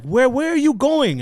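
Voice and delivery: high, loud, slow mock questioning; border woman questioning. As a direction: imitate an official calling out slowly. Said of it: "the woman's like".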